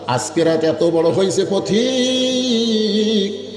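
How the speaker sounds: slow, stretched final word; a slow, chanted plaint; drawn-out lament. Acted. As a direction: let the last word ring out long. Despairing mother voiced in story.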